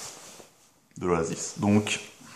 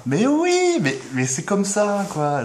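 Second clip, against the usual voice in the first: hammed-up squeal; 11 semitones higher, slower than usual. Hammed-up resignation.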